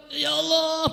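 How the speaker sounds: high, dismayed outcry; hammed-up wail of dismay; acted anguished cry. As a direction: cry out to God in mock distress. Acted cry of the fallen Sunan.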